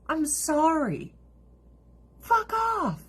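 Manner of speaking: drawn-out, high-pitched dismissal; high, exasperated brush-off. Real, not acted. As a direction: repeat it way up high, stretching the words. Same dismissal repeated for emphasis.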